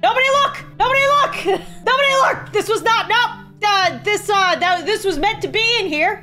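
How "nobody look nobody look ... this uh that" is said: high frantic squeal, laughing through it; panicked shriek. Acted. Developer squealing at viewers not to look. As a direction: shriek it in mock panic, cracking up. Panicked dev hiding broken character.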